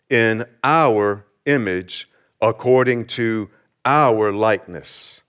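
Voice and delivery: slow, weighted "our" in both phrases; stress on our. Shown as a demonstration. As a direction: press on "our" each time. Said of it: "notice the words".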